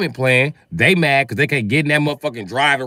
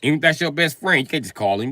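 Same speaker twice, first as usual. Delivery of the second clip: usual speaking voice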